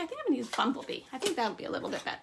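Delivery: ordinary delivery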